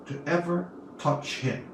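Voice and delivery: ordinary delivery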